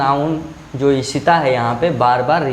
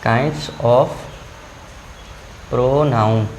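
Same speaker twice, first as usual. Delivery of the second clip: "Kinds of", a long pause, then "pronoun"; slow dictation; 5 semitones lower, much slower than usual. Shown as a demonstration. Dictates while writing, word by word. (धीरे-धीरे रुककर लिखवाते हुए)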